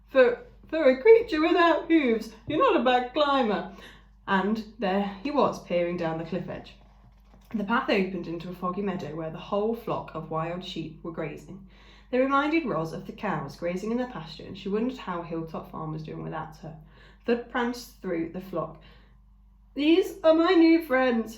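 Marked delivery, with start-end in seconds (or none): 0.1-3.7 higher, loud friendly creature voice; ram calling down. A narrator's reading. Ram calling down the cliff to the robot, friendly. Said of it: "his friendly voice called out". 19.7-21.4 much higher, loud, slow creature voice; ram announcing. A narrator's reading. Ram announcing his new friends to the flock, proud. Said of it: "he announced".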